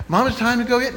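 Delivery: a hint of a pestering kid; impatient child. Impatient boy asks his mother again.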